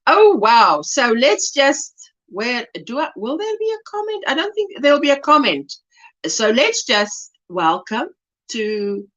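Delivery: ordinary delivery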